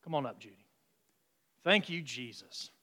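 usual speaking voice

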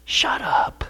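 unvoiced whispered shut up. Shown as a demonstration. Jesus hushing the waves, a soft whisper. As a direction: hiss it softly at the waves. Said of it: "That's what Jesus said".

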